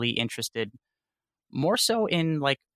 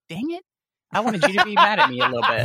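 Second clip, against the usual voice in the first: high, louder, laughing mock pout; mock disappointment; 11 semitones higher, 6 dB louder than usual. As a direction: whine it in fake frustration, laughing through it. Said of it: "I'm like".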